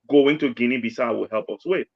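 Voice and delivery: usual speaking voice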